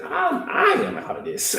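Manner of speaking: high and laughing through it; laughing quit. Acted. Judas walking away from Jesus, laughing. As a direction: laugh as you bail out.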